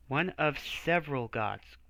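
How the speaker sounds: usual speaking voice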